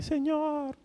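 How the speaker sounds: high, drawn-out mock cry to God; hammed-up sobbing plea; mock tearful wail. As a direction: wail it like an exaggerated tantrum. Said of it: "un mar de lágrimas y patalear".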